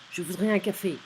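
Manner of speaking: curt, loud demand with falling end; rude. Shown as a demonstration. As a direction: bark the order bluntly. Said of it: "Sounds a bit rude".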